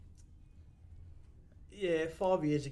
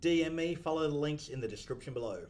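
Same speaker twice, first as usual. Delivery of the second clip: podcast outro; 5 semitones higher, 7 dB louder than usual. Acted. Reads the show outro.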